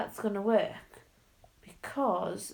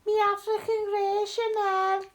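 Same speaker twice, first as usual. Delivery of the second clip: high, put-on Scouse coo; mock Liverpudlian woman; 12 semitones higher, 5 dB louder than usual. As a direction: coo over the parrot in a hammed-up Liverpool accent. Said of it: "she's like".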